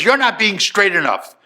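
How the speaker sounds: loud, high-pitched scolding; angry rebuke. Retold or imitated. Father scolds son during the phone call, angry and loud. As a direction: play an exasperated, furious dad.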